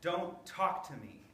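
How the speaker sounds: sulky. Acted. Stephen glaring, still mad.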